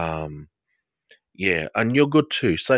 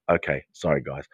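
normal talking voice

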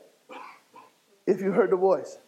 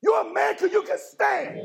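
full-force preaching; 8 semitones higher than usual. Acted. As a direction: hammer it home like a preacher at the peak of the sermon.

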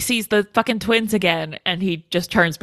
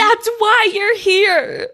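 high, drawn-out outraged cry; mock exasperated wail; 12 semitones higher, 3 dB louder, slower than usual. Mock scolding of the husband.

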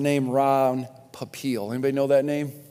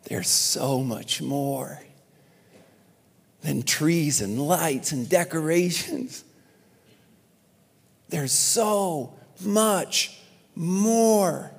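reverent; 3 dB quieter, much slower than usual. Acted. Reverent Christmas line, slowed.

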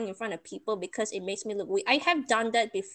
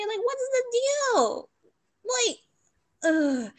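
higher, slow exasperated complaint; fed up with the instructor, higher and slow; 12 semitones higher, slower than usual. Retold or imitated. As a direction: stretch it out in disbelief. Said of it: "I was just, like, thinking".

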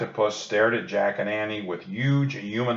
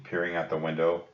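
ordinary delivery

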